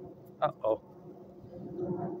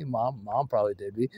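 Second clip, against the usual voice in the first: normal talking voice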